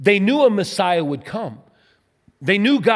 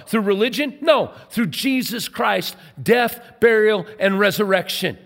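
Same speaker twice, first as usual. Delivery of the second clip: rising, louder preacher's call; preacher pressing each answer; fervent preaching; 4 semitones higher, 3 dB louder than usual. Acted. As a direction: drive it home from the pulpit. Preaching builds to a peak.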